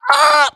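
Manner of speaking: short, very high, loud yelp; sharp startled shriek. Real, not acted. Player listening at full volume, startled shriek. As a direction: let out a sudden high shriek.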